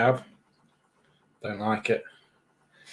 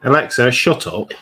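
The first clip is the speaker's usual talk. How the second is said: raised-voice order to be silent; loud command; 9 dB louder than usual. Real, not acted. Interrupting Alexa's long answer, loud command. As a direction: cut the device off loudly. Cutting off the rambling assistant.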